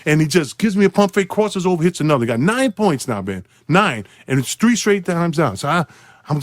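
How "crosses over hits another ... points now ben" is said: usual speaking voice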